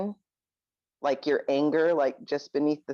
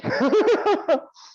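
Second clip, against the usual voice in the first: nervous high laugh; 4 dB louder than usual. Real, not acted. Laughs after thanking everybody.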